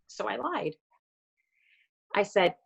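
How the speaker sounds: normal talking voice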